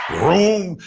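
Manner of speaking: playful imitation of a zooming car; race car vroom. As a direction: rev it like a cartoon racing car. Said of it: "sounded like Speed Racer".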